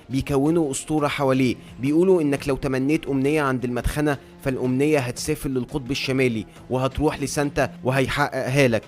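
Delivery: ordinary delivery, nothing marked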